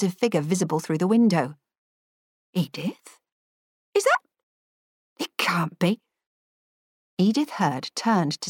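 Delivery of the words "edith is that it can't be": hushed, stunned disbelief; barely believe what she sees; disbelieving. A narrator's reading. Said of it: "disbelieving tone".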